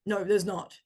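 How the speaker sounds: a stubborn inner critic pushing back; harsh inner voice. Acted. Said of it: "if you hear a harsh voice".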